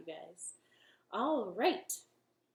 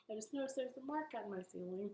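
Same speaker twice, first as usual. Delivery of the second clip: whispered aside; 12 dB quieter, much faster than usual. Real, not acted. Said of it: "unvoiced whisper aside".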